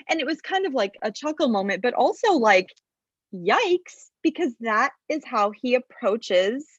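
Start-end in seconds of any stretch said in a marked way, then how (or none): none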